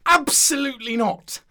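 high, loud indignant refusal; exasperated shout of refusal; indignant outburst. Acted. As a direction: burst out with it, outraged. Objection escalates after searching for words.

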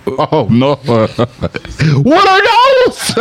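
high squeal of what are those through laughter; high laughing meme shout; laughing mockery. Real, not acted. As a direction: crack up at the shoes. Mocking shoes, laughing.

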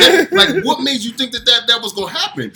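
high-pitched, loud mock outrage; indignant retort. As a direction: act out your incredulous comeback, voice shooting way up. Retelling his indignant reply.

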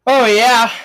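loud, high oh yeah; hammed-up loud satisfaction; loud delight. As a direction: belt out the pleasure. Candy delight.

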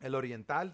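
"El Oriental" with rolled Spanish r and stress on tal; Spanish pronunciation. Shown as a demonstration. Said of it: "is it Oriental".

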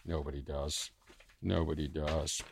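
low, quiet, sorry for himself; self-pitying mutter. Real, not acted. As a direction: mutter it into your chest.

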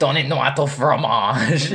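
a hint of a hammy French accent; French phrase tossed off in a mock French accent. Joking for friends, a light mock French accent. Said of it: "I'm gonna speak French".

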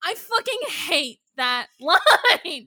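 emphatic, exasperated venting; angry. Real, not acted. Host venting about a hated line, angry. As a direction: drag the words out in outrage. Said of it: "I'm angry".

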